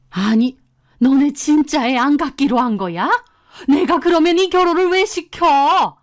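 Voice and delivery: outraged, high-pitched challenge; indignant scolding voice; angry mother-in-law. A narrator's reading. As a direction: confront the couple, building to a high protest. Mother-in-law confronts couple in story.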